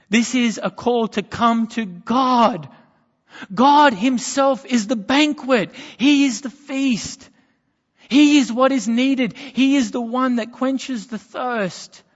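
preaching at full force. Acted. Preacher to his congregation, proclaiming God as the feast, full force. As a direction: lift the room, proclaim it.

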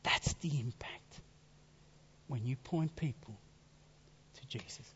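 whispered closing thought. Real, not acted. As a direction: drop to an intimate whisper. Closing hushed point.

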